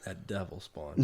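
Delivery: soft laugh through the words. Real, not acted. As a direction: chuckle it out. Joking about the dog.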